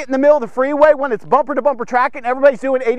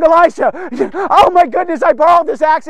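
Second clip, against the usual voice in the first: mild panic, higher and louder; panicking; 6 semitones higher, 4 dB louder than usual. Acted. Prophet calling Elisha about the borrowed axe, panicked. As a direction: call out in a fluster, voice raised. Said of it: "he's panicking".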